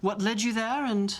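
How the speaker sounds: posh West End English; Jamie Saint Andrews. Acted. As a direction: chat politely in a posh West End accent. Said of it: "my posh West End accent".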